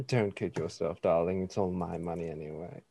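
low, smug and soft. Acted. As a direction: purr it smugly. Jack teases in character.

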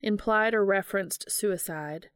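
ordinary delivery

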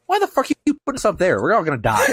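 high-pitched, comic mock protest; hammed-up panicked griping; retold outburst. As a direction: squawk the complaint fast, breaking into a laugh.

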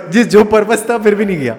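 loud laughing through words. Real, not acted. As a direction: burst out laughing mid-sentence. Laughing at own failed retake.

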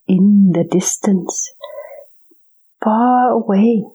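whispered story narration. A narrator's reading. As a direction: whisper it like a bedtime tale.

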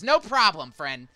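loud, high "No problem" then easy drop; merchant agreeing. Acted. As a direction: cheerfully accept the brush-off. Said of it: "He goes".

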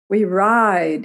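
rousing cry with a long final word; bellowing. A narrator's reading. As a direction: bellow it to the riders. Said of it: "he bellowed".

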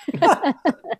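an amused burst of laughter; real laugh. Real, not acted. Guest laughing at her South Philly beach joke, real laugh. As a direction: laugh at your own joke.

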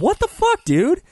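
high, loud disbelief; incredulous. Real, not acted. Cohost reacting to an awful movie line, loud high disbelief. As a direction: yelp it in disbelief.

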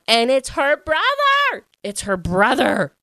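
loud, high, excited outburst; thrilled reveal. Real, not acted. Book fan revealing the guard is her brother, real excitement. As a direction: burst out with the big twist. Reveals the book's big twist.

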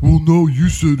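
loud, high dopey voice; dumb guy voice. Retold or imitated. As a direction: talk back like you think they're stupid. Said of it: "like I'm dumb".

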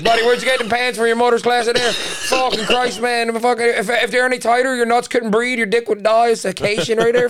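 a heckling pal mocking tight pants; mocking buddy. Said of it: "the whole room will be like".

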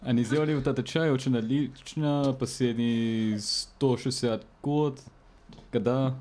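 spoken in Russian, mock ad read. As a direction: translate the blurb into Russian on the spot. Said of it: "read it in a Russian accent or translate it in Russian".

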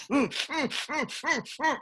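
high strained hums through gritted teeth; a tense hum as the pecs fire up. Shown as a demonstration. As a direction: grunt the breaths through clenched teeth. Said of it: "so you just go".

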